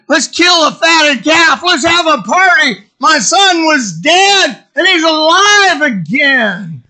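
overjoyed father shouting, high and drawn out; jubilant shout. Acted. Father announcing a party for his returned son, jubilant shout. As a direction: proclaim it loud, slow and high in elation.

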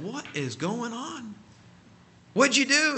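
high-pitched, incredulous questioning; acting out a man's bewilderment, stretching the first question; retold astonishment. Retold bewildered question to praying man.

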